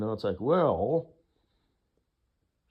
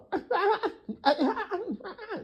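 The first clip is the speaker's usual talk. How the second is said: zebra bray; 3 dB louder than usual. Shown as a demonstration. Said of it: "That's what they sound like".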